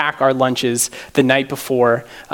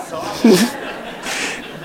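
airy high laugh; quick silent laugh; breathy laugh; 8 semitones higher, 3 dB quieter than usual. Real, not acted. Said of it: "laughing at himself".

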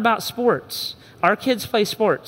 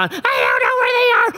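put-on whining kid; 9 semitones higher than usual. Kid whining to dad in the minivan, high crying whine. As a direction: whine like a flustered child.

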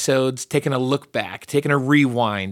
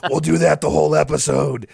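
loud, needlessly aggressive growl; mock-menacing loud superhero voice; Batman; 3 semitones higher, 6 dB louder than usual. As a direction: keep up a hammed-up hero growl. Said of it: "just a needlessly aggressive voice".

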